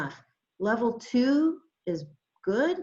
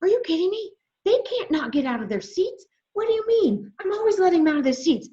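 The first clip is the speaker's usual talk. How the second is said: high, loud retold outburst of disbelief; freaking out; 9 semitones higher, 5 dB louder than usual. Teacher retelling protest over the seat rule, freaking out. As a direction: go off indignantly, pitch leaping up, rushing the end. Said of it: "I'm freaking out. I'm going off".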